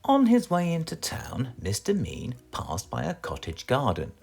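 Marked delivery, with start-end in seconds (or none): none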